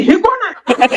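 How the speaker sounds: high, startled mock yell at a stranger in the dark; imitating a wife's shocked cry; startled shout. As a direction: shriek it out, half laughing. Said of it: "ती जोरात ओरडली".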